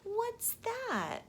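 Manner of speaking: put-on childlike squeak of curiosity; high curious child. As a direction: pitch it up like a curious kid. Child asks eagerly.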